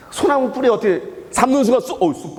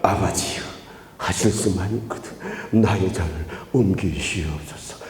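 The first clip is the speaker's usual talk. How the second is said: very low, halting, murmured prayer; mock subdued prayer; 14 semitones lower, 5 dB quieter than usual. Preacher imitating Jesus praying too mildly in Gethsemane, low and subdued. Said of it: "이렇게, 그렇게 기도했을까요?".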